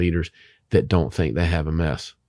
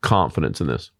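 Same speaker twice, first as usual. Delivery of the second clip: normal talking voice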